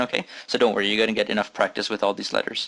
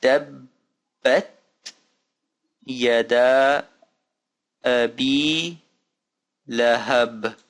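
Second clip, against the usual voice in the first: each word drawn out with long pauses; slow careful reading; much slower than usual. Shown as a demonstration. Teacher reading another example for learners, slow and spaced. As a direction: recite it word by word, holding the vowels.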